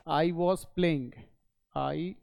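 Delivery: each word spaced out slowly, with long pauses; slow dictation. Shown as a demonstration. Teacher to class, dictating slowly word by word. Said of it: "तो उसका लिखेगा".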